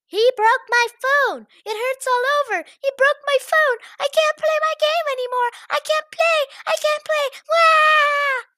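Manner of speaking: very high, loud wailing tantrum; bratty crying kid. Acted. Crying boy complaining to the headmistress, wailing tantrum. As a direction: whine like a spoiled child.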